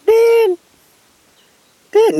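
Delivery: very high, loud piping call; bird yelling out. Retold or imitated. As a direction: ring out like a bird calling a lost dog. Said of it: "he yelling out to the dog".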